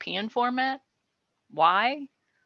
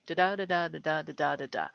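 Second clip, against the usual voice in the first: five da-das, each second syllable stressed, fading softer; iambic pentameter rhythm; 3 semitones lower, 4 dB quieter, faster than usual. Shown as a demonstration. Teacher chants pentameter beats, fading out. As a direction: chant the beat of the line, trailing off. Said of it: "you get iambic pentameter".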